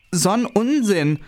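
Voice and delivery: high, slow indignant outburst. Acted. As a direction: huff it out, offended.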